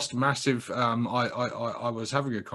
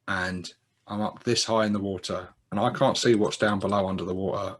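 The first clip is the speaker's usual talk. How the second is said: ordinary delivery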